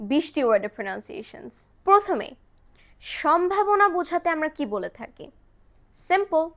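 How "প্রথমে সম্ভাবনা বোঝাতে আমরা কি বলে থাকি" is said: ordinary talk, nothing marked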